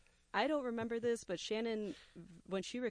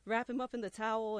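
ordinary talk

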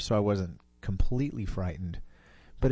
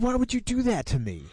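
indignant friend; 4 dB louder than usual. Retold or imitated. Friend protests after the prank.

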